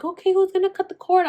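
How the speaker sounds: high chirpy nurse question. Retold or imitated. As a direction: imitate the nurse asking. Staff asks about cutting the cord.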